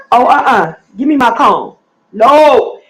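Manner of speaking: loud, sharp, firm grandma telling him off; snatch it back with a loud no; real loud scolding. Real, not acted. Grandma loudly scolding grandson for taking her cone.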